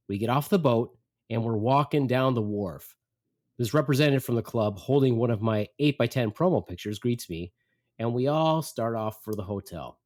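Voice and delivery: normal talking voice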